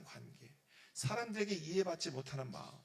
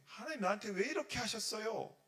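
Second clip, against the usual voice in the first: mock angry protest, pitched higher; angry complaint; 6 semitones higher, 3 dB louder than usual. A believer asks God why, acted angry complaint. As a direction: plead crossly with God, half in earnest. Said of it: "화가 나거나 내 마음속에".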